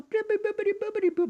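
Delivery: high fast beeping gadget noises; technology noises. Acted. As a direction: chirp it like a gadget. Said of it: "Those are my technology noises".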